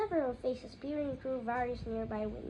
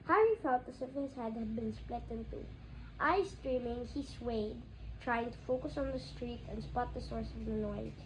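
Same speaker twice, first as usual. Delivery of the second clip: plain reading voice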